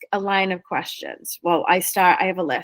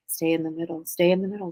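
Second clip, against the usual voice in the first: soft nudging voice; 3 semitones lower, 6 dB quieter, faster than usual. Retold or imitated. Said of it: "You're driving".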